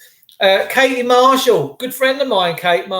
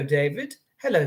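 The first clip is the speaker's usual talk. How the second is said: ordinary talk, nothing marked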